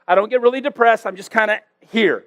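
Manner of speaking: usual speaking voice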